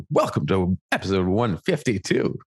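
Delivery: imitating a co-host's radio announcer voice; hammed-up announcer welcome; mock radio voice. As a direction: put on a radio host voice, teasingly. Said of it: "put your radio voice on for that".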